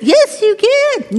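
high, slow, drawn-out coaxing; hammed-up insistence, stretched out and high; insistent tempting thing. The thing argues back at the person, teasingly insistent. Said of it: "this thing over there that says".

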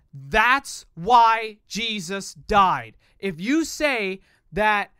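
loud, emphatic preaching; preacher voice. Real, not acted. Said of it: "my yelling voice, my preacher voice".

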